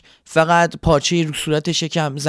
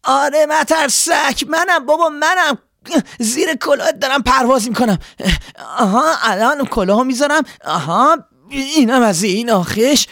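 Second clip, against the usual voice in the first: shouting from afar; 9 semitones higher, 3 dB louder than usual. Acted. Swallow shouting to the scarecrow from afar, high and loud. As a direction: call across the field, pitched much higher. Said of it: "بلند فریاد زد".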